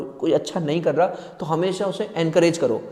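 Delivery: ordinary talk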